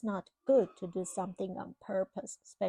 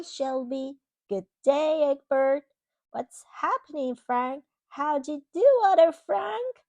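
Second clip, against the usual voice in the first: bright, louder string of happy hellos; cheerful greetings; 4 semitones higher, 7 dB louder than usual. Acted. The good egg happily greeting old carton friends, louder and higher.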